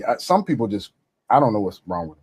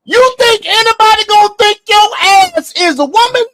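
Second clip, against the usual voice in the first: incredulous shout; 10 semitones higher, 4 dB louder than usual. Real, not acted. Mocking someone's looks, shouting.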